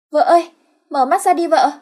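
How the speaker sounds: high, loud simpleton calling; high simple husband calling his wife; childish worried call. Acted. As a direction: wake her like a scared child. Ất shakes unconscious wife.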